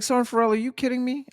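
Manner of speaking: stunned sergeant asking; incredulous. Retold or imitated. Sergeant's disbelief, retold.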